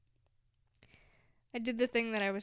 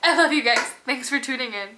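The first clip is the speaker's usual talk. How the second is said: much louder beaming goodbye; real loud joyful farewell; excited farewell; 14 dB louder, faster than usual. Real, not acted. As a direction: call out the love.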